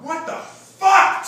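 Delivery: very loud, high and slow shocked cry; shocked outburst. Retold or imitated. Father hearing of the elopement, loud slow shocked cry. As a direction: let the disbelief explode. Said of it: "my mind went from".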